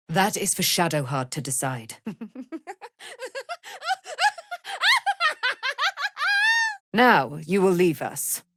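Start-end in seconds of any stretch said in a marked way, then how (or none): 2.0-6.9 high, ringing laugh held long; long gleeful laugh. Acted. Aylin savouring her freedom, long ringing laugh.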